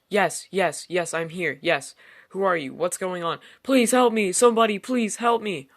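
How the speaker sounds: van gejaagd naar een hoge, luide schreeuw om hulp; panic rising to a scream. Acted. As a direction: bouw op tot veel hoger en luider smeken. Panicked plea on the phone.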